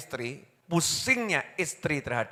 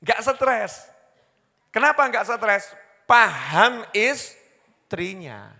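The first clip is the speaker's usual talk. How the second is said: louder, higher, Paham drawn out very slowly; preaching emphasis; 6 semitones higher, 5 dB louder, much slower than usual. Real, not acted. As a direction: drive the point home.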